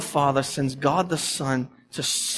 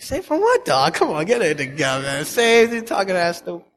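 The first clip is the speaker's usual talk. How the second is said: lampoon a dismissive local; mocking a Boston skeptic who laughs off being saved. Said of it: "they're like".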